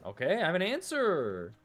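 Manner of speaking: high, loud mock-eager reply; playful echo. Playfully echoes the eager player.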